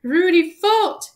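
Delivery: loud, high, drawn-out acted wail; hammed-up tearful outburst; sobbing. A narrator's reading. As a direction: wail it slowly, full of self-blame. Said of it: "he sobbed".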